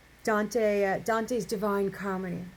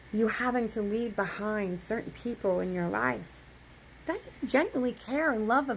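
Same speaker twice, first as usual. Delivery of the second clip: ordinary talk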